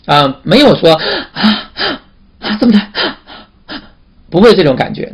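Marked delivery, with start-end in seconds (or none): 0.9-2.9 sharp, high gulps of air; demonstrated gasping. Shown as a demonstration. Teacher shows the class what uneven breathing is not, mock gasping. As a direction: gasp in exaggeratedly between the words. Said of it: "那是倒吸气".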